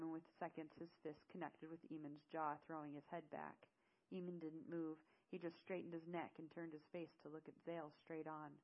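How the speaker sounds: ordinary delivery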